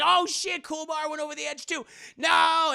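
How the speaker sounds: high, loud cry of dismay; mock alarm. Storyteller reacting to the hero going over the edge, high loud alarm. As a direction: wail the bad news out high. Narrator cries out at hero's fall.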